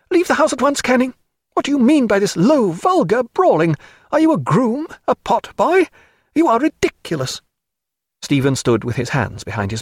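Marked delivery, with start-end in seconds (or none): 0.1-7.4 high, indignant woman's tirade; crying out, scolding. A narrator's reading. Diana ordering Canning out of the house, indignant. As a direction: scold him at high pitch. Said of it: "crying out".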